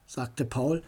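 ordinary delivery, nothing marked

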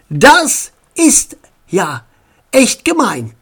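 grandmother's slow, emphatic disapproval; indignant. Acted. Grandmother outraged at the prank.

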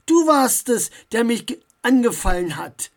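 indignant, loud retort; outraged disbelief turning to accusation; angry. A narrator's reading. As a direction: throw the accusation back angrily. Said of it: "Max war wütend".

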